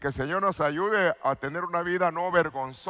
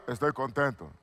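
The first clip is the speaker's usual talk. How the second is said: joyless mock happy; 5 semitones lower, 7 dB quieter than usual. Shown as a demonstration. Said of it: "Y no se le nota nada".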